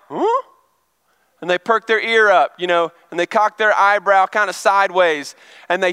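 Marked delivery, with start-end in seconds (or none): 0.1-0.4 a sharp, high questioning huh; like a mom who just caught her baby's cry; alert "huh?". Retold or imitated. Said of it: "all the moms are like".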